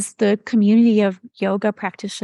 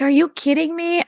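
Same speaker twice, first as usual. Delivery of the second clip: mock diva whine, higher; 8 semitones higher than usual. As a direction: play the put-upon diva. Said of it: "gave him my best diva voice".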